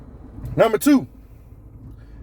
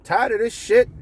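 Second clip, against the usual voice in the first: much higher, excited agreement; eager yes; 9 semitones higher than usual. Retold or imitated. Worker eagerly accepting a coworker's bar invite. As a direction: jump at the offer of a beer. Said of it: "your first instinct is like".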